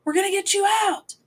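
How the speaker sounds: put-on high, shrill-free shout over a screaming kid; yelling. A narrator's reading. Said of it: "and Johnny yelled".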